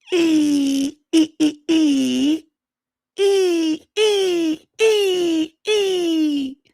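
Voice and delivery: high squealing dolphin cries; dolphin squeak. Pretend. Streamer voicing his dolphin toy, high squeaks.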